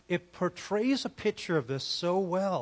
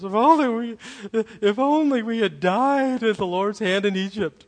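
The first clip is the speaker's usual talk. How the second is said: whiny voice; 5 semitones higher, 7 dB louder than usual. Shown as a demonstration. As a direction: whine it like a spoiled crowd. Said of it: "read this with a whiny voice".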